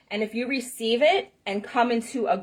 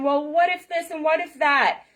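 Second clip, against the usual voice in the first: imitating mother; 6 semitones higher than usual.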